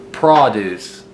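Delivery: "produce" stressed at the front, PRAH-dooce; noun pronunciation. Shown as a demonstration. Teacher naming the farm crop, "produce" as PRAH-dooce. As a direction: say "produce" as the food, stress on the first syllable. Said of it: "you pronounce it produce".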